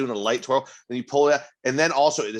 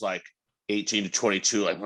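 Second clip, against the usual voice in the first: normal talking voice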